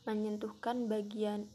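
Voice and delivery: ordinary delivery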